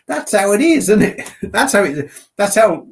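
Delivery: high, loud laughing words; cracking up at his own joke; laughing loudly. Real, not acted. Laughing loudly at his own Aussie impression.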